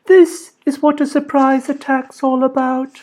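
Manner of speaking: put-on high, clear child spirit; calm, high, pure voice; Spirit of the Rainbow. A narrator's reading. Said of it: "said the Spirit of the Rainbow".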